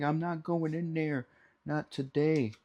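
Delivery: high, soft cartoon voice for the butter; stubborn butter voice. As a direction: play the butter refusing to go in. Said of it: "He's like".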